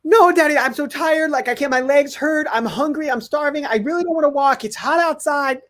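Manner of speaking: put-on high-pitched protesting little kid; whining child. Son begging dad to skip the walk, whining. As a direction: imitate a kid piling up whiny excuses. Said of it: "his Janet kill switch goes off".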